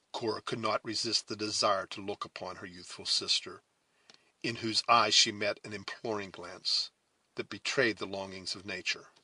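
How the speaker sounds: ordinary delivery, nothing marked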